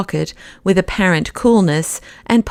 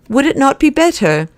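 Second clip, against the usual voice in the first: a little sharp; 7 semitones higher than usual. A narrator's reading. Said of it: "he said a little sharply".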